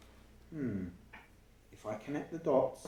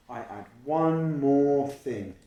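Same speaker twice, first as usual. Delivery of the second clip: excited idea; 2 semitones higher, 8 dB louder, much slower than usual. Acted. Narrator's gasp of idea.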